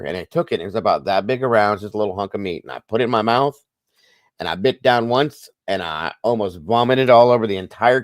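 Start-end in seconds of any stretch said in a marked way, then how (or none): none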